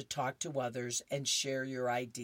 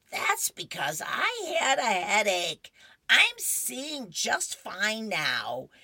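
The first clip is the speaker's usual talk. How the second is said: put-on high, louder, slowing old lady; Opal voice; 8 semitones higher, 7 dB louder, slower than usual. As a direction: snap back indignantly, then slow. Wife answers husband in comic.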